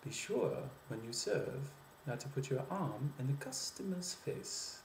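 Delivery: French accent with pitch swooping up on "sure" and "arm"; French version. Shown as a demonstration. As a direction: say it with a rising French lilt. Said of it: "the French version".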